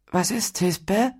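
high, slow, dopey voice; dopey falsetto puzzlement; dim-witted. A narrator's reading. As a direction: ask it like a slow-witted simpleton. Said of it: "fragte Flaut dümmlich".

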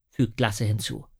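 ordinary delivery, nothing marked

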